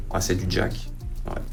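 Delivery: normal talking voice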